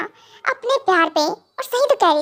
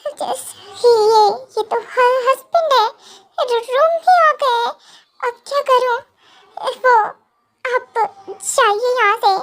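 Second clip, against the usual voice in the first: put-on high, halting, tearful girl's voice; frightened stammer; 5 semitones higher than usual. Kookie warns that her husband is here, frightened stammer. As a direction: stumble over the words in fright. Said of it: "तुम इतना डर क्यों रही हो".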